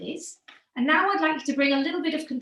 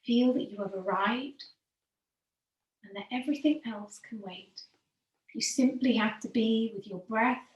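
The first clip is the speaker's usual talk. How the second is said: calm yoga teacher easing the class into stillness; guided meditation; 3 semitones lower than usual. Acted. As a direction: guide them gently into the breath, letting phrases sink at the end. Leads breathing meditation.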